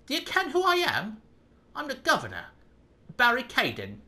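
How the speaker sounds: puffed-up jailer voice; gruff governor. Acted. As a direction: bark it as the stern prison governor. Voicing the prison governor.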